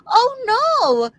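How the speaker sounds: high, loud storybook cry of dismay; crying out as Bear. A narrator's reading. Tutor voices Bear out of berries, dismayed cry. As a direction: cry it out in mock alarm. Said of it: "cried Bear".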